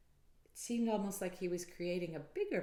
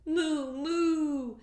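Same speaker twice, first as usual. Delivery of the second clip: higher moo with a laugh; cow moo; 11 semitones higher, 12 dB louder than usual. Acted. Reading to a child, a laughing moo. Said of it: "And the cow said".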